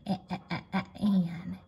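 soft short "eh" repeated, then "an."; sounding out vowel. Shown as a demonstration. Teacher finding the A in van, repeated vowel sound. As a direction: bounce "Eh, eh" softly into "an.". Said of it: "A again".

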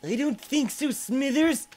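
high, put-on sneering boss voice; hammed-up cartoon boss turning Smithers down; mock villain boss. Boss refusing his assistant Smithers, high and sneering.

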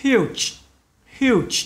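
"Huge" said high, final sound stressed; final sound demo. Shown as a demonstration. Teacher showing students the final consonant, careful demo. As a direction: model the ending of "huge". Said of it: "Các bạn để ý âm cuối này".

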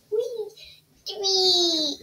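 high squeaky wee; playful squeal. Real, not acted.

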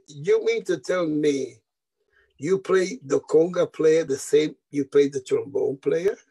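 slow, measured disbelief of a boss; slow incredulity. Retold or imitated. Agent questioning a bandleader over the payroll, slow incredulity. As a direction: draw the question out in mock disbelief.

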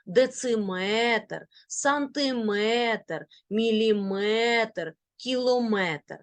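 Дециметр, сантиметр with drawn-out метр; final-syllable stress. Shown as a demonstration. Teacher drilling units of length, end stress. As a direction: slowly stretch метр in міліметр, кілометр. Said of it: "на останній склад".

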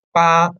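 "ba" held high and level; first tone ba. Shown as a demonstration. Teacher showing that first-tone ba means eight. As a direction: keep "ba" high and flat. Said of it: "it means eight".

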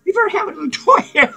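ordinary talk, nothing marked

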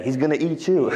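mock a young student's blunt answer; mimicking the youth. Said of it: "That's what all the youth say".

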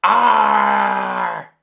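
very loud pirate roar; lusty ear-busting arr. Acted. Night watchman alarmed by boarders, huge loud arr roar. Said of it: "a lusty, ear-busting".

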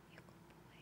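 tender whisper to a pet; whisper to the dog. Real, not acted. Owner whispering to her dog, a tender whisper. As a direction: softly whisper love to the dog.

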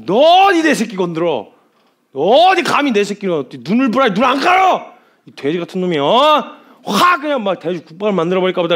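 loud, high-pitched acted rage; furious threatening. God threatens whoever touched his child, hammed-up fury. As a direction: yell threats like an enraged parent. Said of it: "막 협박을 있는 대로 쏟아놓기".

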